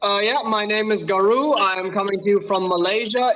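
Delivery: normal talking voice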